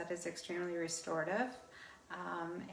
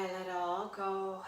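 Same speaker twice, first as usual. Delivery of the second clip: guided meditation. Real, not acted. Leads guided relaxation at yoga nidra.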